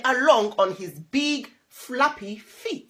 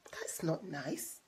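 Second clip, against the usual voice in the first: voiceless whisper to the listener; whispered aside; 6 semitones lower, 15 dB quieter than usual. Real, not acted. Parent whispering a comment to the child, hushed. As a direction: lean in and whisper it. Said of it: "aside to child".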